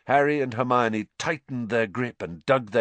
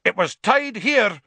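high, loud furious protest; 11 semitones higher, 6 dB louder than usual. Acted. Executioner finding the prisoner gone, furious shout. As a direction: shout it in outrage. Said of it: "said the executioner furiously".

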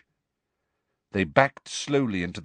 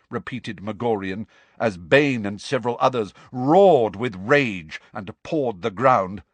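ordinary delivery, nothing marked

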